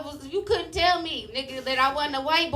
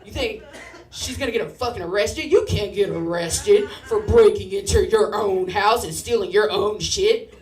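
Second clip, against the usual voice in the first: louder, indignant rant; mock angry Dylan; 4 semitones lower, 6 dB louder than usual. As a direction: friend scolding the kid who objected, mildly heated. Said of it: "Dylan got mad".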